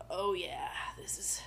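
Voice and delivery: hushed awed whisper. Retold or imitated. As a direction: breathe it like a revelation. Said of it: "being like".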